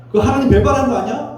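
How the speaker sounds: high-pitched mock outburst of accusation; angry reproach. Pastor imagines rebuking Naaman for betraying God, angry. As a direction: act out an indignant challenge, pitched well up. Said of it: "하면서 화를 내지 않겠습니까".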